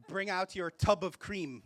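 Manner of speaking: usual speaking voice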